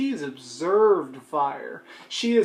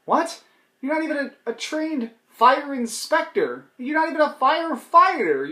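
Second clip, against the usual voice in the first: incredulous; 9 semitones higher than usual. Acted. Crowd scoffing at her credentials.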